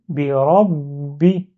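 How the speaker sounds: "birabi" with the last b held shut before releasing the i; blocked ba. Shown as a demonstration. Teacher repeats what was heard, "birabi" with a blocked b. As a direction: say "birabi" slowly, doubling the b as in rabbi. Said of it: "comme si je bloque le ba".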